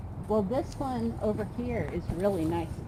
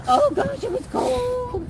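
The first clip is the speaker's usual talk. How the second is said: exaggerated shock; 6 dB louder, slower than usual. Real, not acted. Telling about freezing house.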